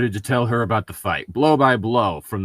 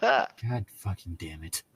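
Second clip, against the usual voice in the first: slow, soft defeated curse; weary grumble; 3 semitones lower, 9 dB quieter, slower than usual. Real, not acted. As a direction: groan it out, beaten. File too big again.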